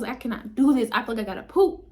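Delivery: loud, insistent protest; re-enacted pained exclamation; retold desperate outcry. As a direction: blurt it out like you can't take it. Reliving panic during contractions.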